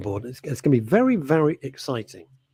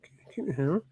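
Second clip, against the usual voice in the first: whispered aside; 3 semitones higher, 6 dB quieter than usual. Real, not acted. Hushed aside.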